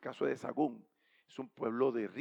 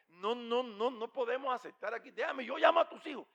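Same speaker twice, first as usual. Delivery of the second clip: high, protesting refusal; agitated objection; 8 semitones higher than usual. Retold or imitated. Wife refusing her husband's wish, agitated. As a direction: protest in a raised pitch.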